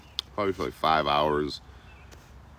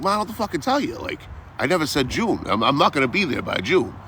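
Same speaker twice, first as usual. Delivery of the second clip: exasperated shouting back; 11 semitones higher than usual. Retold or imitated. Retold argument with mom on phone.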